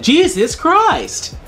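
exasperated. Retold or imitated. Exasperated reply to dumb predator.

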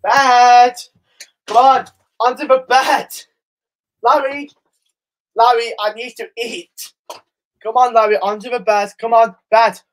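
loud call to the dog to get on the bed; calling Larry the dog onto the bed, loud. Real, not acted.

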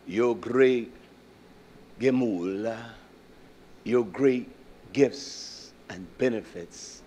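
soft, low, slow plea to Yah; humble prayer. Acted. Preacher voicing the psalmist to Yah, soft humble plea. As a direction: pray it quietly in humble thanks.

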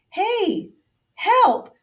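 high, loud storybook cry; urgent call for help. A narrator's reading. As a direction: call out for rescue. Urgent plea in Pete's note.

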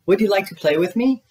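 high, hopeful called question; storybook Pooh asking, rising at the end; calling out. A narrator's reading. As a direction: call up an invitation to a friend. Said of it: "called Pooh".

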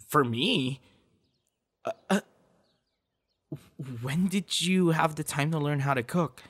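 high, excited disbelief; amazed at the gift; surprised. Real, not acted. Surprised at homemade food.

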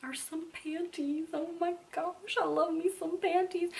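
high, soft, drawn-out coo; mock-dainty squeal kept soft; playful cooing. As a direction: coo over it in a small high voice. Playful reveal of underwear.

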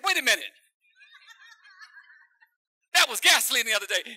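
loud, high startled objection; alarmed protest. Acted. Young doctor seeing the jug, loud alarmed protest. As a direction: catch on in a panic.

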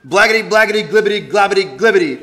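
high, loud gibberish patter; silly sounds. Acted. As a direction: rattle off goofy nonsense loudly. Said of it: "Make silly sounds like".

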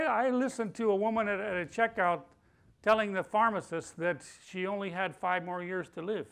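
ordinary talk

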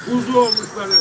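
normal talking voice

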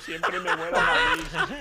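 high, slow, laughing wail; frustrated laughing whine. Real, not acted. Complaining about being killed again.

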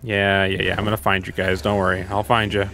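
usual speaking voice

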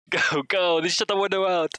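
high, loud frantic urging; urgent shouting. Real, not acted. Player urging the car to go, shouting urgently.

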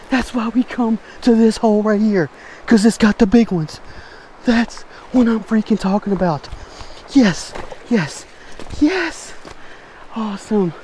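high, joyful celebration; excited cheering. Real, not acted. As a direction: cheer it out, elated. Celebrating the big catch.

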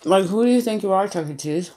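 chewing while talking; talking around food; mouth full. Real, not acted. Seen 0: Bit pizza at -3s; lips closed and working while speaking.

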